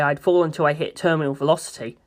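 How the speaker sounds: plain reading voice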